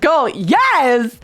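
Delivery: high, excited retold exclamation; acted-out keen acceptance; eager yes. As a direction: burst out with a stretched, eager yes. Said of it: "I'm like".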